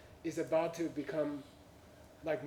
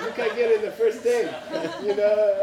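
laughing; 4 semitones higher, 9 dB louder than usual. Real, not acted. Laughing through the punchline.

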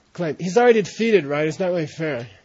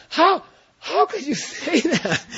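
high, incredulous, pitched up; rising challenge; 11 semitones higher than usual. Shown as a demonstration. Teacher showing how a debater's voice goes up. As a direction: let the voice climb on the question. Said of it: "your voice goes up".

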